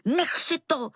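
vysoko položené podrážděné zvolání, ne hlasité; hraný křik. A narrator's reading. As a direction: zvolej to výš a podrážděně. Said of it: "křičela jsem". (mírné rozzlobené okřiknutí)

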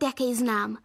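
usual speaking voice

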